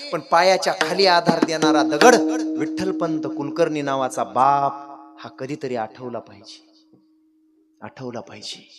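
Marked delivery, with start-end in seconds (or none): 5.2-8.7 quiet, low-pitched earnest urging; hushed plea. Real, not acted. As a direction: drop low and soft after the climax. Preacher's hushed plea after climax.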